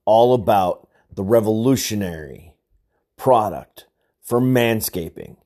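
loud, slow ad read. Acted. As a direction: sell it like a commercial. Reads sponsor ad.

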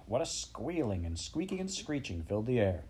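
plain reading voice

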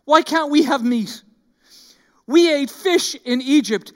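mock-grumbling Israelites moaning about their food; whiny complaining Israelite. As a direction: whine it like a spoiled crowd. Israelites whining at Moses.

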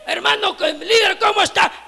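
upbeat pastor's hello; eager greeting. Retold or imitated. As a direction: greet him like you're glad to see him. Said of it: "con ganas y sin ganas a la vez".

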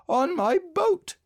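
very high, slow little bear voice; proud, high-pitched bear. A narrator's reading. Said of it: "said Pooh proudly".

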